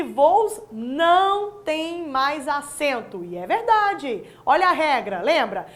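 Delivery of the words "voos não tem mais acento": very slow, each word drawn out from voos; a teacher slowly confirming the right answer on voos; slow emphasis. Shown as a demonstration. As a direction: drag it out to drive the rule home. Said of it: "parabéns para quem disse".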